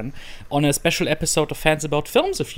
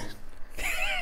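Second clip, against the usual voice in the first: breathy burst of real laughter; quick unvoiced laugh; 11 dB quieter than usual. Real, not acted. Laughing at his own intro.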